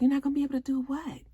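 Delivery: high, fast shocked question; surprised and shocked. Retold or imitated. Husband asking his wife in shock, high and fast. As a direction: ask it high and quick, stunned. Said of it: "he was like".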